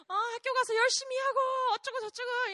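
high, rushed, cheery chatter; imitated jolly dad. Dad cheerfully sending daughter to school, imitated jolly voice. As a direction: rattle it off like a delighted father. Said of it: "so happy and jolly".

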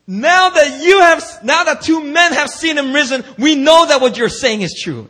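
high, loud mock believers' voice; hammed-up disciples declaring belief; voiced disciples. Preacher voicing disciples, loud hammed-up declaration.

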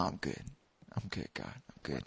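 low, soft, fading brush-off; quiet, dismissive self-cover; muttered denial. Retold or imitated. Acting his denial, brushing God off.

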